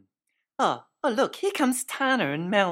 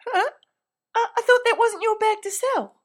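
puzzled; 5 dB louder than usual. Acted. Puzzled question to Tana.

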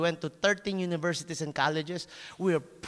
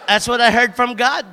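firm assurance; 5 semitones higher, 11 dB louder than usual. Retold or imitated. Teacher affirms her word.

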